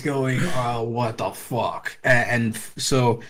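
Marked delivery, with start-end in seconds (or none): 0.6-2.0 soft, slow, deflated mock groan; exasperated groan. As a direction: sag with weary disbelief, slowly. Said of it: "slapping foreheads".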